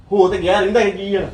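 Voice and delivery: bitter outburst. Acted. Son tells mother the home is already ruined, loud. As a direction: throw it back at her.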